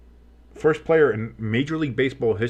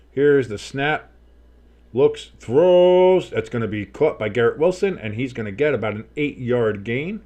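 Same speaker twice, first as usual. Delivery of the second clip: rising commentary with a drawn-out, louder call on the throw; play-by-play announcer; 4 dB louder, slower than usual. Pretend. Streamer calling a live snap and throw for viewers, play-by-play.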